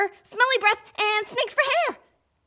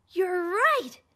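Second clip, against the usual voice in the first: ordinary talk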